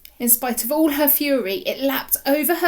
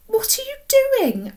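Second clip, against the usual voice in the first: put-on puzzled child; 4 dB louder than usual. Boy asks, voiced child.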